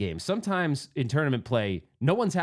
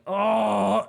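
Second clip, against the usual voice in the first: drawn-out, higher-pitched "oh"; frustrated groan; 6 semitones higher, 4 dB louder than usual. Retold or imitated. Player retelling his reaction to a drained ball, frustrated groan.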